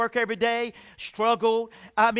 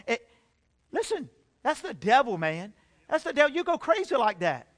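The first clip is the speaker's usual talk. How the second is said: ordinary talk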